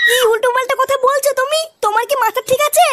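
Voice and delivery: wife scolding her husband for his shameless talk, angrily; angry scolding. Acted. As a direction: tell him off in disbelief.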